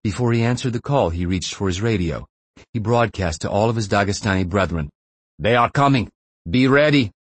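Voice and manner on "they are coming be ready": urgent shout. Acted. Rebel leader radios his men.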